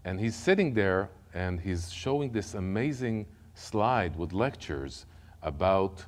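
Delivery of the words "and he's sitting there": ordinary talk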